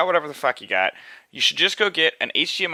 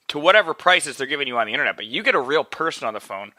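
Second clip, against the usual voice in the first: normal talking voice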